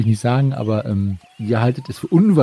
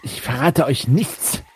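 slow, then a high defiant cry; defiant refusal; 4 semitones higher, 4 dB quieter, slower than usual. Acted.

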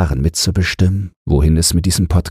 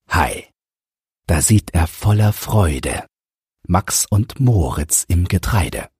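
storybook narration. A narrator's reading. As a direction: read the rhyming tale to listeners. Reads a verse story aloud.

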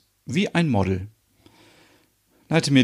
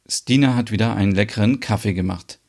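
ordinary talk

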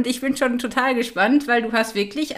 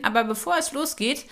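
ordinary talk, nothing marked

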